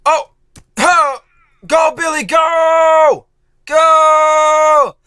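high loud cheering; cheering shout. Real, not acted. Gamer yelling at Billy to go. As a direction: yell him on to the finish.

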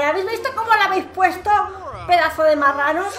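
fast, scolding voice of a fed-up Sim; quick mock telling-off. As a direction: tell them off like an exasperated mum. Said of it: "y encima les echa bronca, en plan".

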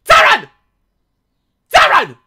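loud mum calling her son; yelling across the room. Pretend. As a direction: shout the name up to the roof.

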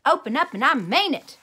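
loud demanding yell; yelling at the locked door. A narrator's reading. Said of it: "I yelled".

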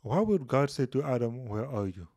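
ordinary talk, nothing marked

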